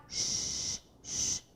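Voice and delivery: quiet voiceless Sh, sh; sh sound. Shown as a demonstration. Teacher showing pupils the C H sound again, a soft sh. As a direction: breathe out a soft sh. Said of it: "Não é do C H?".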